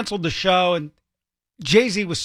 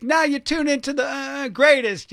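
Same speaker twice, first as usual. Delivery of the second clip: imitated intro; 9 semitones higher than usual. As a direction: mimic a high-pitched intro announcement. Imitates a recorded intro line.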